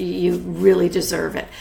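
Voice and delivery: ordinary talk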